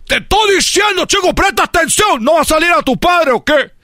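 high, shouted telling-off; scolding father. Acted. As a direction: yell at your son in exasperation. Father scolding his son. (padre regañando a gritos)

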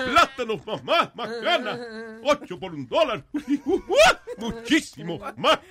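high, slow, laughing announcer; radio ad voice. Retold or imitated. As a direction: ham up the radio pitchman. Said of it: "ese era el estilo de él".

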